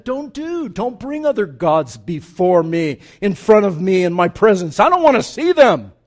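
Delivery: God commanding loudly, no other gods. Acted. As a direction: thunder it down as God forbidding. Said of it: "that God said".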